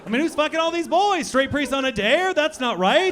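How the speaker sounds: loud, high outraged question; indignant rant. Real, not acted. Comic outrage at the crowd, loud and high. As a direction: demand an answer from the crowd.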